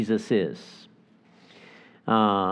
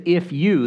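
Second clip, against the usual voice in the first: "If you" pushed up high and firm; stress on if you; 6 semitones higher than usual. Shown as a demonstration. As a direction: hit "If you" hard, emphatic. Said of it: "it's emphatic".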